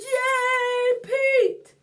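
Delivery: high, loud cheer of a crowd. A narrator's reading. Friends cheer Pete after his touchdown, high and loud cheering. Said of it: "and everyone cheers".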